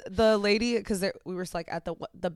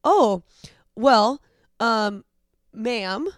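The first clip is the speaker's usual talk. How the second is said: loud hesitant oh, well, trailing into ma'am; pointed correction; 2 semitones higher, 7 dB louder, much slower than usual. Retold or imitated. Correcting the lady who called her sir, drawn-out and pointed. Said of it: "I was like".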